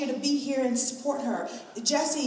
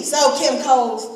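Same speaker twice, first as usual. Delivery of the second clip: mocking security guard; 4 semitones higher, 8 dB louder than usual. Security guard taunting her about her career, loud. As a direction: heckle her like a rude stranger on the street.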